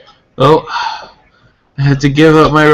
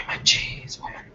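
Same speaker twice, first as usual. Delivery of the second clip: whisper; 17 dB quieter than usual. Pretend. Mock demand after laughing.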